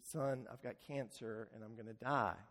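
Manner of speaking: low and soft, gently final; a father breaking it to his son, hushed and low; quiet tender admission. Retold or imitated. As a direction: say it softly to your son. Father tells son he will die.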